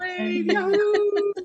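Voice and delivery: high, slow drawn-out yahoo; excited yahoo. Real, not acted. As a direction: whoop it up for the crowd. Urging audience to wave.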